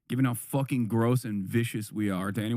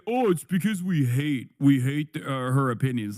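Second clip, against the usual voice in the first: louder, whiny mock of a hater's excuse; 4 dB louder than usual. Streamer mocks hateful viewers' excuse, in a loud whiny mock voice. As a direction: parody their fake reasoning, pushed and loud. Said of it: "They will say".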